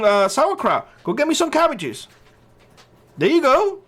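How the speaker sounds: high, taunting commands; mocking orders. Mock orders to laid-off workers.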